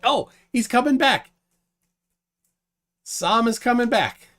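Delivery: high, loud, lifted call; excited announcement. Real, not acted. Host spots the guest returning, excited and high. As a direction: pitch jumps up at the good news. Missing guest suddenly reappears.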